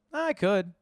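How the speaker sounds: perked up. Real, not acted. Debating aloud whether to leak it.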